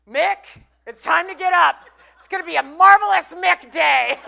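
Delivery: high, loud, laughing sing-song wake-up call; roommate's impression of a sing-song wake-up. Roommate mimicking the son waking him, high and loud. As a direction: belt it out like a giddy alarm clock. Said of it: "he comes into my room and he goes".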